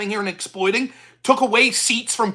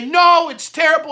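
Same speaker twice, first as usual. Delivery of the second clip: mock plea; 6 semitones higher, 5 dB louder than usual. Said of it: "they're gonna plead and say".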